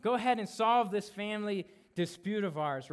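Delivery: high, loud mock demand from the crowd; pushy man ordering the teacher to settle it; demanding statement. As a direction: boss Jesus around like a pushy sibling. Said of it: "it's more of like a, a statement".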